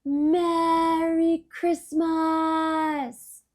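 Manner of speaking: very loud, high, slow drawn-out shout in a changed voice. A narrator's reading. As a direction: call it out over the train's noise. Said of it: "he shouted".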